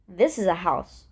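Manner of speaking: ordinary talk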